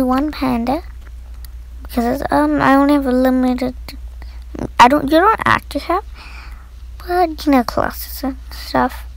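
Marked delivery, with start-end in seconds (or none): none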